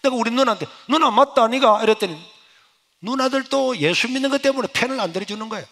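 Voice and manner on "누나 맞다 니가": louder, higher indignant challenge; raised voice demanding a sister take sides; indignant appeal. Retold or imitated. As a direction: call out the sister in disbelief. Pleading with sister in quarrel.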